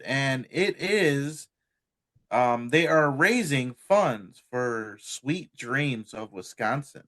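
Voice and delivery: slow, deliberate announcement; slow talk. Shown as a demonstration. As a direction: take each detail slowly. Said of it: "I'm going to talk like this".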